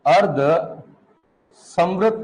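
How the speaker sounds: drawn out syllable by syllable, x0.3 slow; stretched dictation of अर्धसमृत. Shown as a demonstration. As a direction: spell it out slowly so they can write. Teacher dictates word to write.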